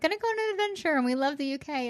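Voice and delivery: excited. Retold or imitated. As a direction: gush like you're off on an adventure. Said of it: "we were like".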